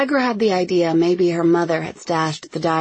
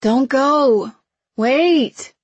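urgent shout after him; calling out; 8 semitones higher, 6 dB louder, much slower than usual. A narrator's reading. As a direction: call him back, loud and high.

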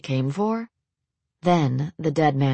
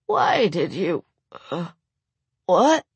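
confused babbling; slower than usual. Acted. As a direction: stumble over the words. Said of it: "Raymond babbled".

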